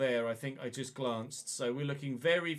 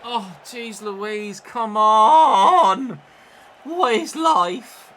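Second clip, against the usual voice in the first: slow, drawn out, then a loud cry; exasperated yell; 6 semitones higher, 16 dB louder, much slower than usual. Real, not acted. Gamer yelling at his team after a miss, loud and drawn out. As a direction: groan it out, then shout in disbelief. Goal chance wasted in the game.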